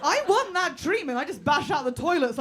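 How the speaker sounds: ordinary talk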